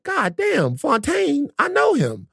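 high, thrilled exclamation; like spotting an old friend; excited. Retold or imitated. Excited recognition quote.

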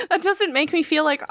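ordinary talk, nothing marked

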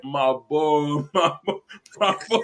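put-on laughing through a rising my boy; chummy bro voice. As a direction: grin through each my boy. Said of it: "And he's like".

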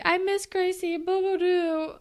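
high, babyish mock lament; a high, pouty mock of the mother; mock whiny mom. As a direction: mimic her pouting. Mocking the mom's sad line.

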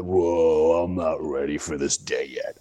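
very low, sluggish morning voice; groggy waking groan. Retold or imitated. Acting out himself getting up unready, groggy. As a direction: drop right down like you just woke up.

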